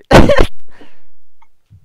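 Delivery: very high, loud squealing laughter; shrieking laugh. Real, not acted. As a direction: cackle uncontrollably. Cracking up again.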